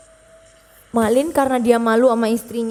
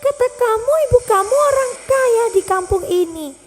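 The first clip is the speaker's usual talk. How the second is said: disgusted snob; 13 semitones higher than usual. Acted. Rich wife mocking the old mother, disgusted. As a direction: squeal your contempt like a spoiled rich girl.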